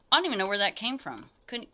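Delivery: ordinary talk, nothing marked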